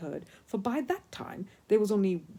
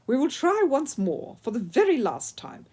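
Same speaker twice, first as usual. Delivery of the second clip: exasperated Italian instructor, higher and louder, slow; Italian ski instructor; 5 semitones higher, 9 dB louder, slower than usual. A narrator's reading. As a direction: coach a hopeless pupil in a thick Italian accent. Signor Alberto voiced with Italian accent.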